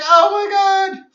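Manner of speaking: very high, loud, drawn-out exclamation; stretched, hammed-up squeal of awe; astonished outburst. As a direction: act out overwhelming amazement. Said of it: "I'm like".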